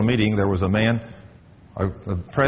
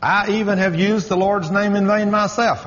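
higher, confessing boss; imitating a sheepish vice president, pitched up; 10 semitones higher than usual. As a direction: voice the VP owning up. VP's admission quoted.

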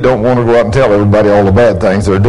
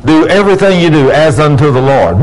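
normal talking voice

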